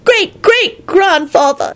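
put-on high, drawn-out little boy's reply; mildly tearful high voice; sniffing child. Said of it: "sniffed Teddy and blew his nose".

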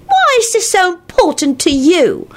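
put-on high-pitched haughty child; lofty. A narrator's reading. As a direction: answer like a child acting superior. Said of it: "replied Teddy loftily".

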